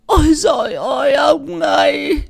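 high, loud, drawn-out crying wail; wailing lament. A narrator's reading. Old mother calling on her husband to come see, wailing. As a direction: wail out to him in despair.

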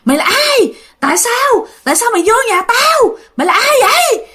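high-pitched angry demand from a ghost; menacing female ghost, voice pitched right up; fierce ghost shriek. Retold or imitated. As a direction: wail the questions high and fierce. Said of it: "Mà nó gào, nó dữ, nó nói".

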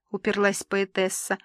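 usual speaking voice

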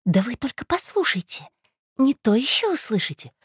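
high, urgent, insistent; conspiratorial woman. Acted. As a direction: press him to keep listening.

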